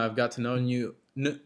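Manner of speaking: usual speaking voice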